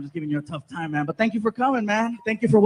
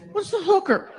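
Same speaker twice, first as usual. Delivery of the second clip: put-on kid asking; 11 semitones higher than usual. Son repeats, high.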